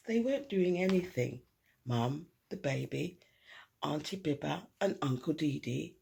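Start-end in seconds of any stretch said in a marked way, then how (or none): none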